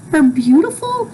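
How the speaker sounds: ordinary delivery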